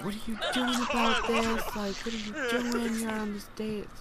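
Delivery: high-pitched mock scolding with a laugh in it; imitating the angry boyfriend. A Sim boyfriend confronting his partner, mock scolding. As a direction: play the jealous guy, half laughing. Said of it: "come over here and be like".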